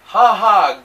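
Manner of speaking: lightly acted knowing chuckle; knowing laugh. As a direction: laugh as if in on a secret joke. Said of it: "laughs as if understanding a joke".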